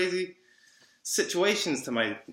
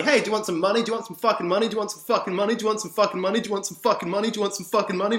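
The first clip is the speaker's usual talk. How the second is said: higher, rapid-fire repeated offer; frantic mock offer; 5 semitones higher, 4 dB louder, much faster than usual. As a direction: keep pushing it on them, mockingly. Mimics himself frantically offering money.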